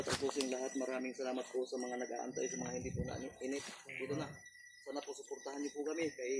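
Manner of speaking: quiet sign-off to viewers; hushed outro. Real, not acted. Hushed sign-off while hiding.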